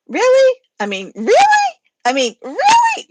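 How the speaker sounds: high, loud squeaking "Really?"; squeaky disbelief. Acted. Friend squeaks back at being called obsessive, high and loud. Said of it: "gave me the opportunity to squeak".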